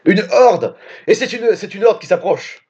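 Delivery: high, urgent alarm call; alarmed cry. Acted. Character warns the group that a horde approaches, high alarmed cry. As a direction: sound the warning as the horde closes in.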